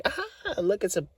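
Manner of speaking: high-pitched imitation of a laughing woman; squealed mock glee. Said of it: "she was, like, laughing".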